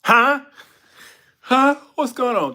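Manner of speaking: loud, high confused reply; startled awake. Acted. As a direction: blurt it out as if jolted from sleep. Samson acted as just woken.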